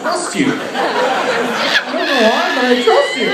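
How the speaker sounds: rising high, mock-starstruck; awed surrender. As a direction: melt into trust. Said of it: "And I was like".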